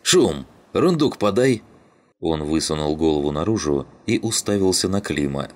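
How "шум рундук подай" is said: calling out. A narrator's reading. Calling to Shum for the box.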